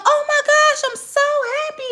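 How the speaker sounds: very high, squealing oh my gosh; intense happiness. Retold or imitated. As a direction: gush with over-the-top joy. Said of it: "I'm gonna be like".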